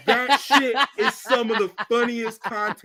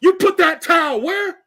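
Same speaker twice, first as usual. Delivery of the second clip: loud, high mocking rant; mock yelling comedian; 8 semitones higher, 8 dB louder than usual. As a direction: shout it like a roast. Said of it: "when he does the women".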